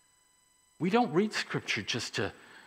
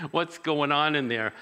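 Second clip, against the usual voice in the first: ordinary talk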